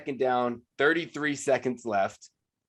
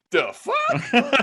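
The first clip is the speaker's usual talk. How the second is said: "The fuck?" pitched much higher in mock disbelief; incredulous "the fuck"; 10 semitones higher, 3 dB louder than usual. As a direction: deliver "The fuck?" as a stunned punchline. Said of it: "To that, I say".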